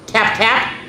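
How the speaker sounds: plain reading voice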